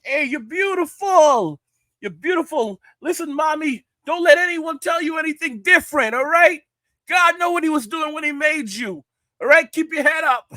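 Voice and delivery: high-pitched New York street guy catcalling; hammy New York accent, loud and high. Retold or imitated. Imitating a New York construction worker catcalling, high New York accent. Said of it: "You like my New York accent?".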